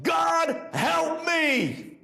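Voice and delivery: high-pitched pleading cry to God; acted desperate scream; retold scream. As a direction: cry out high, drawing the plea out. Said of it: "that I screamed".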